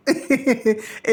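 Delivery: short burst of giggling; a second of light laughter; amused laugh. Real, not acted. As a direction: giggle at your own joke. Laughs at her own praise.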